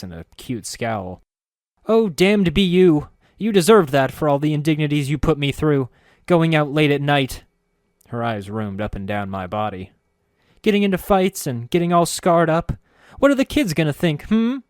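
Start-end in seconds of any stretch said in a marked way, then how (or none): 1.9-7.4 loud, high hammed-up scolding wife; mock fury. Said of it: "Don't break character". 10.6-14.6 loud, high hammed-up scolding wife; mock fury. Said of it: "Don't break character".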